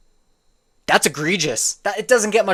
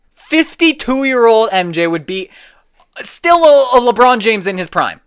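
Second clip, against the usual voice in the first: outraged repeat; 7 semitones higher, 6 dB louder than usual. Real, not acted.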